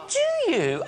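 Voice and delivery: high-pitched impressed question; imitated surprise. Acted passenger amazed by his job.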